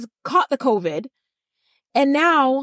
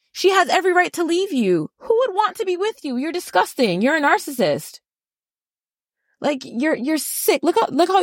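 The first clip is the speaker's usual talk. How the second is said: indignant rant; 4 semitones higher than usual. Real, not acted. Ranting at Cody, pitch raised.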